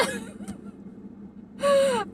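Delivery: squealing high laugh. Real, not acted. Laughing while retelling the doctor visit, high giggle.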